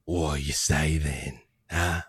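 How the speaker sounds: a charming giant leaning in; deep tiefling. Acted. Tiefling to Tatiana, low and flirty. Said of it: "And he goes".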